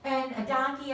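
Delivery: usual speaking voice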